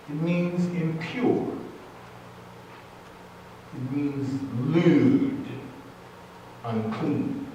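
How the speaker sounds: It means lewd said very slowly; slow emphasis. Real, not acted. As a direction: drag out lewd and unclean. Preacher slows on definitions.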